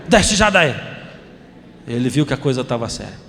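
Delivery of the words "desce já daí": stern order. Retold or imitated. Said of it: "com a cara bem brava".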